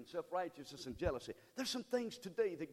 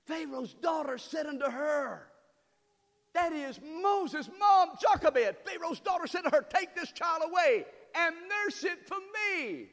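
high, loud, slow preaching; shouting the scripture to the church; preaching at full force; 13 semitones higher, 11 dB louder than usual. Acted. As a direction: proclaim the verse at the top of your voice.

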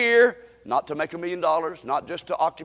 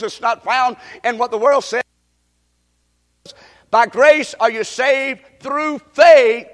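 raised, urgent pulpit voice; full-force preaching; 6 semitones higher, 9 dB louder than usual. Acted. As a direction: drive it home line by line to the congregation. Preaches a sinner's confession at force.

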